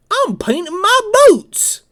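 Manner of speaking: high, loud, cheerful goofball voice; high loud dopey soldier proudly announcing his boots; goofy Thomas voice. Retold or imitated. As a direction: blurt it out like a clueless private proud of his work. Thomas's answer to grandfather.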